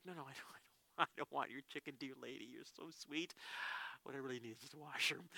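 soft, low-key reassurance; tender refusal. Retold or imitated. Pastor gently turns down the woman's chicken, tenderly. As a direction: decline the gift gently.

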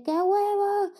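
high, loud, thrilled yell; excited scream. Retold or imitated. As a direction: scream it as you leap. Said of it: "fue como que grité". (grito eufórico y agudo al lanzarse)

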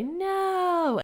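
put-on high, drawn-out children's "No"; kids' chorus no. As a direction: answer like a room of little kids. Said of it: "And they're gonna say".

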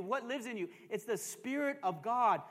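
ordinary talk, nothing marked

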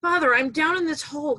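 loud, high, fast calling up; shouting up. Acted. Man in a hole calling up to a priest, loud and high. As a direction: hurry to call the priest from below. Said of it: "the guy shouts up".

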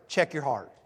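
light impression of a comedian's catchphrase; imitating John Crist catchphrase. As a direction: toss it off low and quiet like the comedian. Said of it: "He's always saying this phrase".

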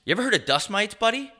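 mock lecturing. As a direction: warn him like a know-it-all. Mock warning about dust mites.

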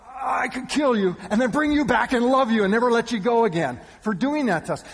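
loud, high-pitched mock outburst; exasperated relief. Parents to the son they just found, exasperated relief. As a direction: gush out love and fury together.

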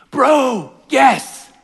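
loud, high excited bro shouting; frat bro. Acted. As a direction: yell like a hyped frat bro at midnight. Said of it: "this is how the story goes".

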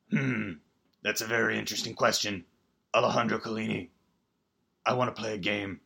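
lightly voiced calm horror villain; a hint of a menacing killer, measured; understated sinister game master; Jigsaw voice. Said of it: "I'm sorry, Jigsaw".

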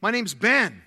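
put-on fired-up young man's introduction, high and loud; Ben. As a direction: imitate an eager, booming greeting. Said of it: "this young guy's fired up".

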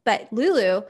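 ordinary talk, nothing marked

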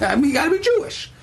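high, fast exasperated squeak. Retold or imitated. Max frustrated with Maria's questions, exasperated high. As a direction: throw up your hands, pitched high and quick.

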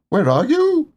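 high, loud searching call. A narrator's reading. Tigger calling for his hidden friends, high and loud. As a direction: call out across the forest. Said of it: "called Tigger".

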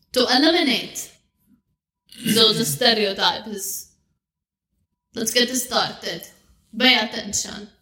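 put-on halting, deliberate Arabic-accented speech. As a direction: make a formal announcement in an Arabic accent, pausing between phrases. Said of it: "we're gonna do the Arabic accent".

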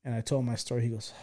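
ordinary talk, nothing marked

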